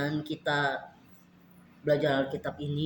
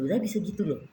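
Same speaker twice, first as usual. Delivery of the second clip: normal talking voice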